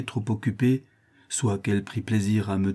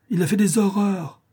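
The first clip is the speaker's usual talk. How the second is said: high, indignant outcry; exclaiming; 8 semitones higher than usual. A narrator's reading. Lignolles denouncing the chevalier to the company, exclaiming. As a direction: cry it out, scandalised. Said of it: "s'écria monsieur de Lignolles".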